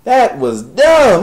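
loud, high, slow repeat; exasperated. Real, not acted. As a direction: yell it the second time.

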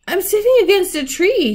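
high, loud astonishment; amazed discovery. A narrator's reading. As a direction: exclaim at a startling find. Susan's startling discovery of a tree.

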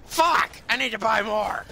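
sudden high furious shout; angry yell. Real, not acted. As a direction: explode in frustration. Frustrated outburst at missing item.

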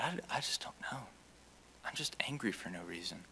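whispered confession. Retold or imitated. To the professor after class, a whispered confession. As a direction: breathe it out, barely voiced.

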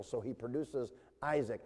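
ordinary delivery

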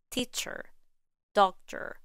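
American "Teacher, doctor" with R-coloured endings; American English. Shown as a demonstration. Teacher showing ER and OR reduced to schwa, American accent. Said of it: "In American English, the E R in teacher".

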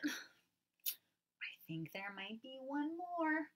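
unvoiced secretive hint; whisper. Teacher whispering a secret clue about a tiny animal.

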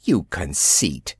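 low, slow, mildly indignant rebuke; a hint of a girl scolding a show-off; understated huffy reproach. A narrator's reading. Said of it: "exclaimed Wendy indignantly".

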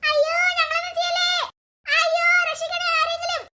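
put-on a terrified child crying out for help in a dark field. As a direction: beg and call out for rescue. Girls cry out for help.

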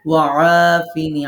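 ওয়াআফিনি said loud and slow with the ain vowel held long; stretched ain. Shown as a demonstration. Teacher shows the class where to stretch, loud and slow. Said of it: "আইনে টানবো".

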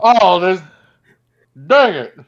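high, loud mock Texas exclamation; Southern accent. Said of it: "a Texas accent or a Southern accent".

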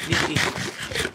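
slow, tense whisper. Real, not acted. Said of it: "Kinda like in tense mode".

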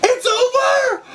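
high, drawn-out cry; high, stretched exclamation; dismayed outburst. Real, not acted. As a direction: wail it high and long. Video ends.

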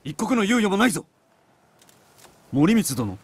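rally them, no time to lose; urgent shout. Acted. Warrior urging his men that the enemy has crossed, urgent shout.

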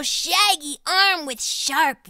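usual speaking voice

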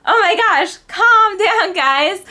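very high, loud exasperated laugh; laughing calm down. Retold or imitated. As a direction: laugh at them while telling them off. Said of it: "and I'm like".

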